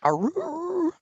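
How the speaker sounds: high mock werewolf howl; wolf howl. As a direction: throw back your head and howl. GM howls for wolfman.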